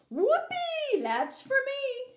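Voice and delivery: high whooping yell; a boy thrilled by a button; high whoop of glee; excited shout. Acted. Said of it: "shouted Mike T V".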